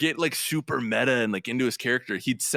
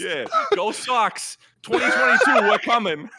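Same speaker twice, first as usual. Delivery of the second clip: high, loud Boston sports fan; Boston accent; 10 semitones higher, 5 dB louder than usual. Pretend. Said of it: "That was beautiful".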